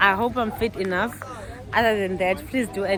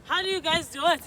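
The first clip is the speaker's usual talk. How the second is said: ordinary delivery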